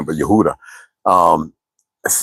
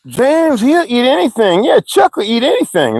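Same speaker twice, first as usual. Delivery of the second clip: classmates teasing; 13 semitones higher than usual. Retold or imitated. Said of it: "Everybody be like".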